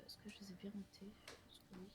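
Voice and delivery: whispering. Real, not acted. Checking notes to herself.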